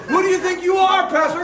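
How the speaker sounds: high mock scolding shout; indignant challenge. President scolding the pastor for going backstage, high mock shout. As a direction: put the pastor in his place. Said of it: "He goes".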